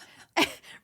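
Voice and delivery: a loud, breathless laugh; real burst of laughter. Real, not acted. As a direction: break into laughter at the absurdity. Laughing at absurd memory.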